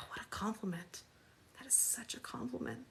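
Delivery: breathy fake flattery, whispered; hushed sarcastic compliment. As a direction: whisper a mock thank-you. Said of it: "that's my sarcasm".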